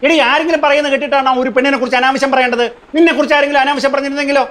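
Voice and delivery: indignant, heated telling-off; heated scolding. Acted. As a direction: scold your wife, voice climbing. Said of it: "ഇതെന്തിനാ ഇങ്ങനെ കിടന്ന് ചൂടാവണത്?".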